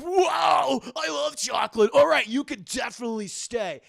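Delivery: loud, high mock delight; hammed-up joy over chocolate; excited shout. Guy hears about chocolate.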